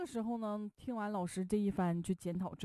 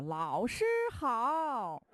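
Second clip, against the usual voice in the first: very slow, stretched, higher and louder chorus; drawn-out class greeting; 6 semitones higher, 4 dB louder, much slower than usual. Retold or imitated. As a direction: drag every syllable like a whole class greeting. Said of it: "齐声高喊说".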